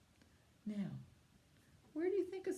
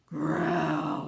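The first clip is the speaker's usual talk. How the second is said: loud growling bear sound; 12 dB louder than usual. Shown as a demonstration. Teacher growling like a bear for the children. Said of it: "can you growl like a bear?".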